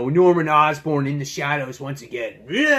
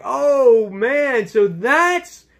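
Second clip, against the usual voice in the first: high, loud burst of surprise; sudden realization; 8 semitones higher, 5 dB louder, much slower than usual. Retold or imitated. Reader realizing who was being interrogated, surprised high exclamation. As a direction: gasp it as the penny drops. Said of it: "And you're like".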